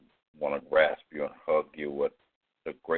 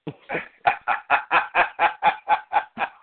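loud, high peal of laughter; long burst of high laughter; big laugh; 13 semitones higher, 11 dB louder than usual. Real, not acted. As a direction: crack up helplessly. Cracking up at a misunderstanding.

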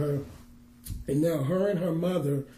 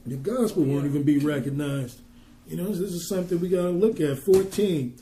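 usual speaking voice

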